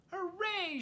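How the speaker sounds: very high, joyful cheer; shouted hooray. Acted. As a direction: cheer it out together. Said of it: "shouted the flock in unison".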